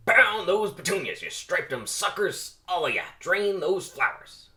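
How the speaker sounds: loud, high barked orders; bellowing drill sergeant; drill sergeant shout. Acted. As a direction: yell it like a drill instructor. Drill sergeant barking at jocks.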